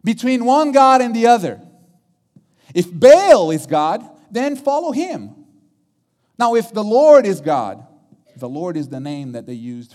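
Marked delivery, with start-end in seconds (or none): 0.0-1.6 loud, high-pitched public challenge; challenging. Retold or imitated. Preacher as Elijah confronting all Israel at Mount Carmel, challenging. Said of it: "he comes in already challenging everybody". 2.7-7.8 forceful, loud ultimatum to the crowd; challenging. Retold or imitated. Preacher as Elijah making Israel choose one God, challenging. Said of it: "he comes in already challenging everybody".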